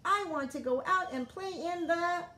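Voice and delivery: eager and high; bubbling over to go play; excited. A narrator's reading. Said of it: "cried Froggy".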